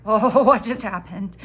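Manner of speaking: amused giggling surprise; laughing. Real, not acted. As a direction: laugh through the question. Laughing at herself.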